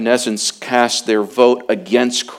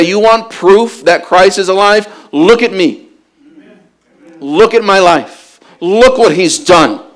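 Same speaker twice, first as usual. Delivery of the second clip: higher, louder preacher's cry; preaching at full force; 5 semitones higher, 7 dB louder than usual. Acted. Preacher calling Christians to be living proof, full force. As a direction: rise to a ringing proclamation.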